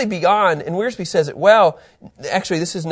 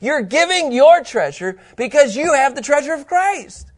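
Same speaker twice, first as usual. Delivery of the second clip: ordinary talk, nothing marked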